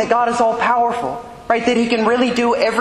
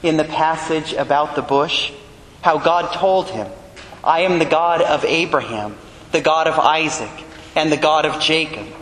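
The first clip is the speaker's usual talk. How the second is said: a lower, solemn proclaimed reading of scripture; gospel reading; 5 semitones lower than usual. Acted. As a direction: read it out as the Gospel at Mass.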